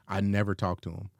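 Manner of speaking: ordinary talk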